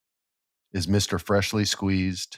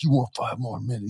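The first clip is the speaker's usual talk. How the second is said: mocking sneer; 4 dB quieter than usual. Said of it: "Max is like".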